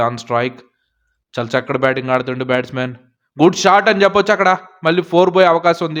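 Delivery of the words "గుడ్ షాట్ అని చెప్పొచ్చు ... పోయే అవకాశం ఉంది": excited. Real, not acted. A good shot is hit.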